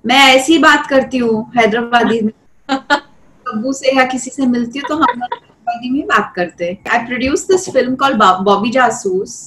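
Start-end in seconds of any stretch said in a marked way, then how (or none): 0.1-2.3 quick Hyderabadi Hindi lilt; Hyderabadi accent. Shown as a demonstration. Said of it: "I can speak in Hyderabadi also". 2.7-6.8 Hyderabadi accent. Shown as a demonstration. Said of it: "I can speak in Hyderabadi also".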